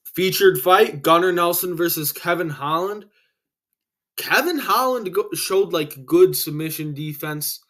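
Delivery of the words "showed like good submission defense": ordinary talk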